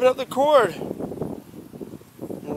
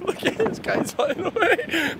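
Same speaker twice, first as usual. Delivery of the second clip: laughing through the words, slower; chuckling as the kites fly off; amused laugh while watching kites blow away; 7 semitones higher, 4 dB quieter, slower than usual. Real, not acted.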